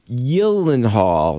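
drawn-out "Gyllenhaal" starting with a Y; Swedish Y sound. Shown as a demonstration. Host demonstrating the Swedish name, Swedish Y sound. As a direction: say "Gyllenhaal" slowly as YILL-en-hall. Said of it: "The G is pronounced as a Y".

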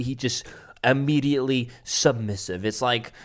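ordinary delivery

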